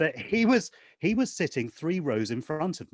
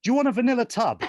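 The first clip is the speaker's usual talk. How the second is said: high, quick, raised-voice offer; shouting across rows; 8 semitones higher, 4 dB louder, faster than usual. Retold or imitated. Fan offering the star ice cream across the theatre, shouting. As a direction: call it over people's heads, fast and pitched up. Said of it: "I found myself shouting".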